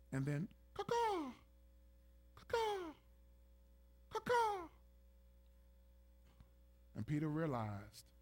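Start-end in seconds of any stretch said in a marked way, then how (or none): 0.7-4.7 very high mock rooster caw; rooster crow. Rooster crows in retold scene.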